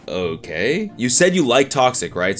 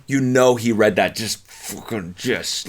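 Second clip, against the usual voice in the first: ordinary talk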